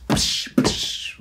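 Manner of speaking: two sharp splashing noises; imitated water splash. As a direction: mimic splashes with breathy hissing bursts. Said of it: "splashes in the water".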